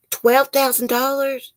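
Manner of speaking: very high, drawn-out question; incredulous shock. Real, not acted. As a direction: echo the sum in real disbelief. Shocked at renter's unpaid bill.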